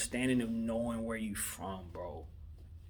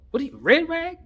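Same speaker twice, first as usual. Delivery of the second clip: much higher and louder, can't believe it; stunned, louder question; incredulous outburst; 9 dB louder than usual. Real, not acted. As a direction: shoot up in pitch in disbelief. Disbelief at the red rag.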